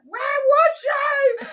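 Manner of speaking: high, loud, drawn-out yell; outraged mock screech; retold losing it. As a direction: shriek at your husband, re-enacted. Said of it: "literally lose it".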